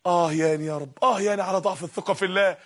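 ordinary talk

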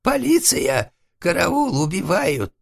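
very high panicked cry for help; hoarse wail. A narrator's reading. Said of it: "и хрипло простонал". (истошный стон о помощи)

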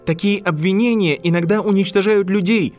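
high, loud furious outburst; rising rage. A narrator's reading. Harry furious at McGonagall, high loud rage. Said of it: "гнев Гарри всё больше выходил из-под контроля".

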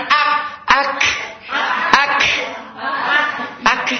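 short high "ak" with an airy k release; hams drill. Shown as a demonstration. Teacher drills the class, "ak" with an aspirated k. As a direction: cut "ak" short, then puff air on the k.

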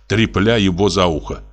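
usual speaking voice

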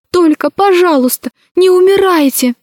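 high, tearful begging; tearful pleading. A narrator's reading. Alice pleads with the empress, tearful. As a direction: plead through tears. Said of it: "заплакала она".

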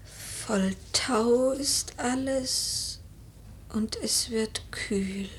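whispered, as if to herself; haunted whisper. Acted. Lady at her lover's grave, whispering to herself. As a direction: barely voice it, lost in dread. Lady echoes the maid dreamily.

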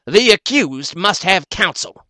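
a lightly voiced man at the meeting; voiced townsman. A townsman speaking up at the trial, lightly voiced. Reads a character's line.

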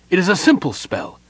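high, exasperated retort; insist, annoyed; angry demand. A narrator's reading. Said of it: "Samar demanded angrily".

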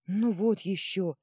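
indignant grumble. A narrator's reading. Gnome refuses to retreat, loud indignant grumble. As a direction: grumble it out, stretching the words. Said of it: "заворчал гном".

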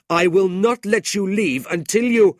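very high, loud, broken-off threat; forceful shout, cut short; furious. A narrator's reading. Brother blocking the carriage door, furious.

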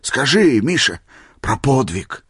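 loud eager urging; crying out. A narrator's reading. Old man asking Misha for his story, calling out. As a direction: call out a keen request. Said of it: "крикнул Семён Устиныч".

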